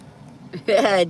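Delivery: short bright laugh. Real, not acted. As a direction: burst out laughing. Laughing at chat comment.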